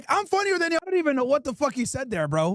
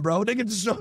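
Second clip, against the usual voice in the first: ordinary talk, nothing marked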